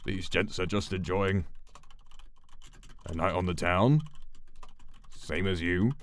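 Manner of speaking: British police officer. Acted. Officer calms George in a light British accent. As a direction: reassure gently like a British bobby. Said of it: "a British police officer".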